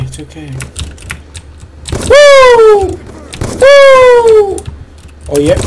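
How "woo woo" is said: loud falsetto "woo"; high whoops. Real, not acted. Player cheering during the game, falsetto whoops. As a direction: whoop high and long, twice.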